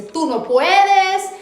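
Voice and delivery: preacher acting out the enemy's discouraging voice, loud and taunting. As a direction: hurl doubts like the devil whispering defeat, but loud. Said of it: "Acalla esa voz que siempre te dice".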